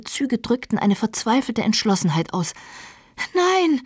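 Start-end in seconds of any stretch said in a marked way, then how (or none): 3.3-3.8 high-pitched desperate cry; shrieking. A narrator's reading. Catherine stops Heathcliff from leaving, shrieking. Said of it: "kreischte sie".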